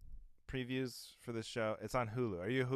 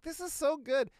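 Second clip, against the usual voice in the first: gushing delight; 4 dB louder than usual. Retold or imitated. Enthused reaction to hearing a song.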